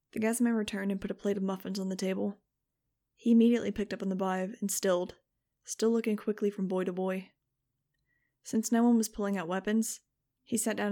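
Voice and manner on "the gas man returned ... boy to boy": ordinary delivery, nothing marked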